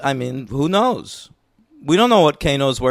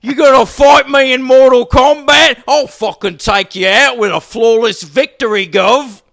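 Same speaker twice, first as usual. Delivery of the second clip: loud, high British hooligan shout; soccer hooligan accent; 8 semitones higher, 6 dB louder than usual. Acted. Playing Kano as a soccer hooligan, loud mock British accent. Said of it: "that was a pretty good accent for you".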